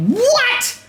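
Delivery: very high, drawn-out cry of disbelief; high-pitched incredulous yelp; shocked outburst. Retold or imitated. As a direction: exclaim it way up high, appalled. Retelling bigger reaction to booking.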